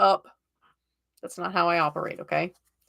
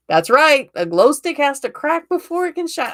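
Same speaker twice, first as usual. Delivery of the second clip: higher, louder Southern accent; 8 semitones higher, 7 dB louder than usual. Shown as a demonstration. Tarot reader delivering a favourite saying to a client, Southern accent. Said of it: "That one I can say in my Southern accent".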